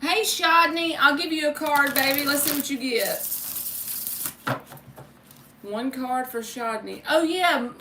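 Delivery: ordinary talk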